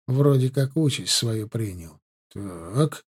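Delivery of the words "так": low, soft sighed-out word; exhaled. A narrator's reading. Martynov deciding who must stay, exhaling. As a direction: breathe it out, pondering. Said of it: "выдохнул Мартынов".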